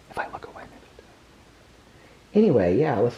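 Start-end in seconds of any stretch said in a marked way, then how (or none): 0.1-1.1 real whisper, trying not to stir the dog; soft hushed aside. Real, not acted. Trying not to disturb the dogs.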